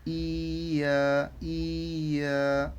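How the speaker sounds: very slow, stretched "ইয়া"; long level vowels on "ইয়া"; drawn-out vowels. Shown as a demonstration. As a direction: hold both the i and the a in "ইয়া". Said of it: "ইটাকে টান দিলাম".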